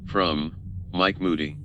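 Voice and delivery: usual speaking voice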